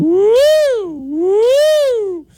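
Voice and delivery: high, loud siren woo; mock alarm siren. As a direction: wail like an alarm bell. Alarm bells start sounding.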